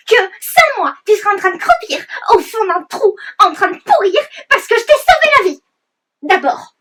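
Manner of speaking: high, loud indignant rant; angry rant. Acted. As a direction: rant at him, outraged. Angry rant at the old man. (tirade indignée)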